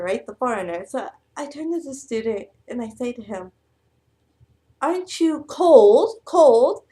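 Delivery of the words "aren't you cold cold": loud, slow, high-pitched teasing question. Retold or imitated. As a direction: call out the question slowly and loudly. Foreign teacher loudly asks cold student.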